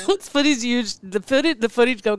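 breaking up with laughter mid-sentence; laughing through words. Real, not acted. Host reading a news item, laughing through the words. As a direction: try to speak while giggling. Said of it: "God, I'm laughing".

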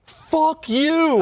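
loud, high-pitched yell at the boss; retold triumphant curse. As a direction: act out a winner telling off the coach. Retold winner curses out the coach.

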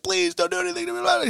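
very high, fast, squeaky mock pleading; terrified plea. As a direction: gabble it out in a hammed-up panic. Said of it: "And he's like".

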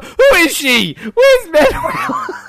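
high, whiny mock outrage; hammed-up wail of complaint; mocking a nitpicker. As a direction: imitate a friend's baffled griping, drawn out. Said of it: "You're like".

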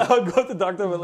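laughing through the words; breaking into laughter while telling it. Real, not acted. Telling a friend about the old lunchroom, laughing.

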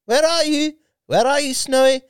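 high-pitched calling for a pet. Retold or imitated. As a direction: call the lion like a lost dog. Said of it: "he's like".

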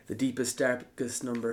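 low, hushed and ominous. Acted. Ominous build-up to final number.

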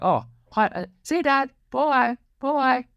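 put-on high tiny toddler voice; little daughter. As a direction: squeak it like a toddler waving bye. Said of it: "And she's like".

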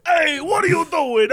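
loud, high New York heckler; New York guy. Acted. New York guy in buddy comedy, hammed-up shout. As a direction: holler it like a cartoon New Yorker. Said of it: "my New York guy".

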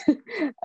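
quick high laugh at her own words; brief real chuckle; light laugh. Real, not acted. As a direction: laugh off a clumsy phrase.

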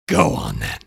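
very low, grudging mock acceptance; deep resigned guest caving in; giving in guest. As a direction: drop the voice deep and give in. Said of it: "And then the guest says".

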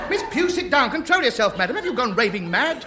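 high, loud appalled telling-off; outraged scolding. Acted. Escort ordering Miss Pugh back into her seat, high outraged scolding. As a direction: rein her in, mortified.

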